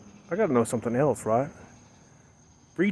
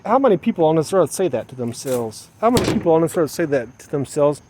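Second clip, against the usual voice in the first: normal talking voice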